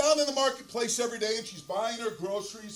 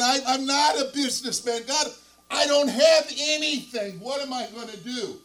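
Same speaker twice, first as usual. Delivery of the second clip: loud, higher-pitched cry to God; acted anguished plea; 4 semitones higher, 9 dB louder than usual. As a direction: play a distressed husband pleading loudly. Distressed husband cries out to God.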